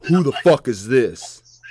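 loud, slow, mock-annoyed phone answer; retold irritated challenge; mock irate. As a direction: demand it like you're fed up, playfully. Acts out answering unknown caller.

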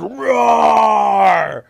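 loud, high, hammed-up roar; monster roar. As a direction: roar like a big hairy monster. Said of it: "growls at them".

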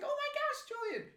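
very high squealed greeting; mock gushing. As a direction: imitate an overexcited acquaintance. Imitating a gushing acquaintance.

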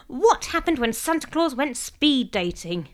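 slow, high, showy delivery; joke-telling. A narrator's reading. Mum reading her cracker joke to family, showy. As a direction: announce the set-up with relish.

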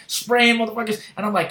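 normal talking voice

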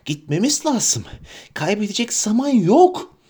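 high urgent creature voice; Gollum voice. Acted. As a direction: wheedle them to hurry. Gollum urging.

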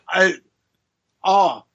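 ordinary delivery

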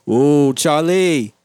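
loud, drawn-out holler of a workman; construction worker. Retold or imitated. Construction worker hailing his buddy outside the window, loud mock holler. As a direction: call out slowly to a workmate. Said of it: "hear the fucking construction workers talking".

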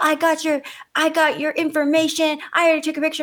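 high, insistent mock yelling; heated, high-pitched retold rant; enraged teen imitation. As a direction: mimic a teen hurling accusations. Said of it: "she's so enraged".

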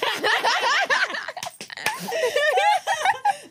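high, burst of open laughter. Real, not acted. Laughing at the end.

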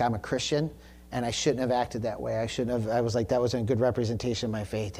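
soft, low retold confession; humble apology. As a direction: own up sheepishly, voice dropped. Retold humble apology to store worker.

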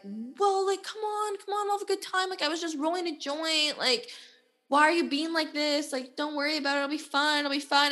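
high, soft pleading voice; mock cajoling, pitched well up; coaxing. Man brushing off her complaint about being left, coaxing.

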